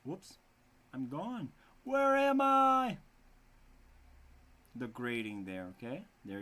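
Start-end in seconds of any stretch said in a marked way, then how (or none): 1.8-3.0 mock lost. Real, not acted. Teacher lost on screen, mock panic.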